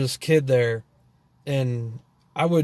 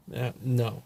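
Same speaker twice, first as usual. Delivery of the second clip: usual speaking voice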